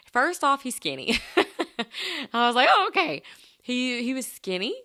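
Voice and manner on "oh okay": high, loud, amused surprise; amused double take; taken aback. Retold or imitated. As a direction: react high and thrown, half laughing. Reacting to unexpected skinny mechanic.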